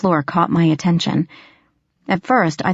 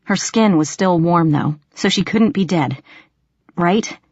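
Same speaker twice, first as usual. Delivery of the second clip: ordinary delivery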